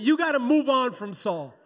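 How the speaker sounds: preacher rousing the congregation to repeat it; like you mean it. Shown as a demonstration. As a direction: belt it out with full conviction. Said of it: "say it like you mean it".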